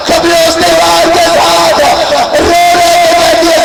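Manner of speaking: rising mourning oration; preaching at full force. Acted. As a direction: drive the mourners to tears, crying out. Majlis lament climax.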